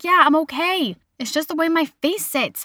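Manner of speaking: retold exasperation. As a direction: insist you're fine, fed up with being asked. Retold fed-up answer to nagging.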